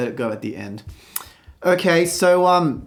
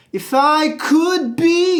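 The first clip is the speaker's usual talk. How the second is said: be sung high and held, could thrown away; stress on be; 12 semitones higher, 5 dB louder, much slower than usual. Shown as a demonstration. Coach aiming the high note at the word be. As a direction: aim the note at be. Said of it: "So I would aim for the word be".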